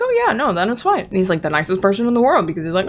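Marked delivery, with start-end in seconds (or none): 0.0-1.0 high, instantly pleased turnaround; sudden mock delight. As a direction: flip from grumpy to eager. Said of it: "all of a sudden the guy's like".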